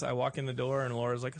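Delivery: normal talking voice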